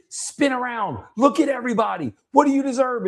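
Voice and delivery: high-pitched fervent coaching; rally someone with the whole group behind them; intense, assertive. Retold or imitated. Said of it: "way more intense and way more loud".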